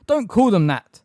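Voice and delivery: loud, high, furious rebuke. A narrator's reading. Hermione rebuking Umbridge for the slur, furious. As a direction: snap at her to stop. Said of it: "Hermione said furiously".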